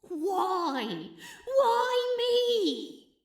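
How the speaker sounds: why me, to the heavens; exasperated wail; despairing whine. Acted.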